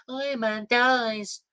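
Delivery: high, drawn-out mocking call; spoiler-shouting moviegoer. Imitating moviegoer spoiling Endgame, high mocking singsong. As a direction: stretch it out in a high put-on voice. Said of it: "that was coming out and said".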